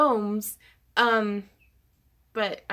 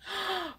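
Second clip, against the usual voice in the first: sudden high gasp of shock; sharp gasp; 9 semitones higher, 8 dB quieter than usual. Real, not acted. A streamer gasps at a surprise donation, sharp high gasp.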